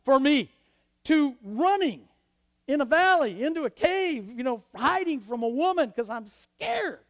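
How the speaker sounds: loud, high sermon build; preaching at full force. Acted. Preacher booming the Elijah story to the congregation. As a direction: drive the story home from the pulpit.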